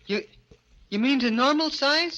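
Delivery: breathless hope. Acted. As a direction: reach for the dream. Hope rising at the news.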